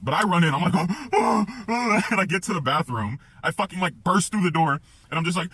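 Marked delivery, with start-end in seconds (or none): 0.8-2.1 loud, high voiced gasps; acted loud heaving breaths; imitated panicked gasping. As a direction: suck in air in frantic panic. Panting as he runs in.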